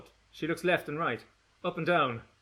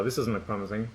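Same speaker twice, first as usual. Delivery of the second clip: ordinary delivery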